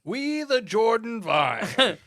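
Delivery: slow, high, grand proclamation voice; mock founding father. Parodying the constitution, a slow lofty proclamation.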